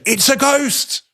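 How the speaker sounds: high, frightened mock cry; mock panic. Disciples in the boat spotting a figure, high mock panic. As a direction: squeal it like a startled disciple. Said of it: "their, their reaction?".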